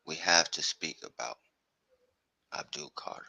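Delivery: whispered, almost voiceless; hushed name reveal. Real, not acted. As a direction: drop to a whisper for the big name. Hushed build-up to the star's name.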